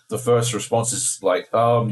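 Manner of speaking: ordinary delivery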